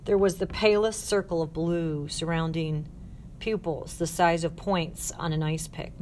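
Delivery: usual speaking voice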